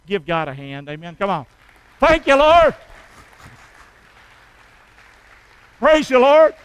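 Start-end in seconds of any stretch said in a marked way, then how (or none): 2.0-6.5 high, loud praise shout. Real, not acted. Preacher praising God before congregation, loud. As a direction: cry out thanks to God.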